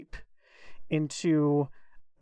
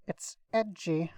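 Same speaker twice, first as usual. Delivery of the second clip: normal talking voice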